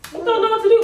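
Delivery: high, loud cry of despair; desperate outcry. Real, not acted. Pleading with her viewers, a real high loud outcry. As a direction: wail it out, at the end of her rope. Desperate plea after long pause.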